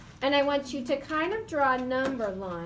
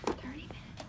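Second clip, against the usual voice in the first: teacher whispering back; soft whispered echo; 12 dB quieter than usual. Shown as a demonstration. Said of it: "In your whisper voice".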